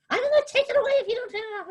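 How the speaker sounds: guard threatening to take the camera, high fast British scolding; fast scolding British old lady. Retold or imitated. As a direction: rattle it off like an outraged little guard. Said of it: "in a really heavy British accent".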